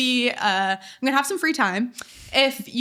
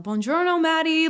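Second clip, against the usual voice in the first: higher, lilting imitation of an Italian accent; attempted Italian accent; 7 semitones higher, 3 dB louder than usual. Host imitating a voice memo from Italy, attempted Italian accent. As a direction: imitate an Italian woman's greeting, amateur accent. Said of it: "like, thick Italian accent".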